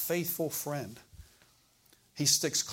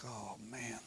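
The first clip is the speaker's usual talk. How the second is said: quiet sigh; 3 semitones lower, 14 dB quieter than usual. Retold or imitated. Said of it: "I was like".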